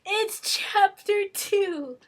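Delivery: high, loud, slow exclamation; exclaiming. Real, not acted. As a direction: announce the find with a drawn-out shout. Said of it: "where'd it go".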